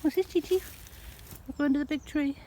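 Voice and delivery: high sing-song voice for the cats; pet talk. Real, not acted. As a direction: coo at the pets in a high voice. High voice talking to pets.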